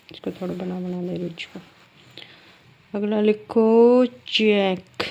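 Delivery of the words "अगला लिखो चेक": very slow, louder dictation. Shown as a demonstration. Teacher dictating the next word to the class. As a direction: read it out slowly for writing. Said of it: "dictating next word".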